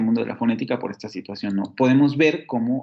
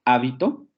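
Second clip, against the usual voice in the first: HÁBITO, golpe de voz en la A inicial; acento en la primera sílaba. Shown as a demonstration. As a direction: marca «Hábito» como esdrújula: HÁ-bi-to. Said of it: "solamente cambian por el acento". («Hábito» con acento en HÁ)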